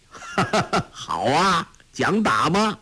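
jovial laugh then amused question; hearty rider laughing at kids. A narrator's reading. Fat rider finds children fighting, laughing. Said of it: "笑道".

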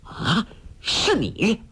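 slow, high cry of recognition; startled shout at seeing the man; shocked cry. A narrator's reading. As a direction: gasp it out in shock. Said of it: "大叫一声".

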